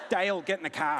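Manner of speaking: high, loud Aussie holler with laughter; broad Aussie accent. Shown as a demonstration. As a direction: call Dale over in broad Aussie. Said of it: "just a good Aussie name".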